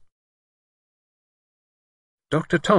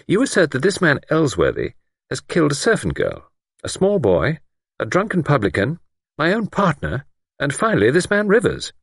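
higher, measured, a hint of a superior doctor; lightly voiced doctor; 8 semitones higher than usual. A narrator's reading. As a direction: play the calm, sceptical doctor lightly. Narrator voices Doctor Thomas.

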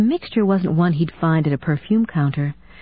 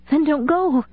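much higher, a little hysterical; high-pitched, mildly hysterical; 10 semitones higher than usual. A narrator's reading. Hallie begging Steve not to leave, high and mildly hysterical. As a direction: plead with him not to leave, near breaking. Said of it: "high-pitched and mildly hysterical".

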